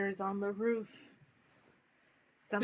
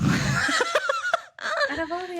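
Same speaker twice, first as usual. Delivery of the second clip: laughing; 9 dB louder, much slower than usual. Real, not acted. Laughing at the Cockney accent.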